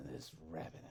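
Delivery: low muttered grumble; muttering. A narrator's reading. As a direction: grumble it under your breath. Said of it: "muttering all the while".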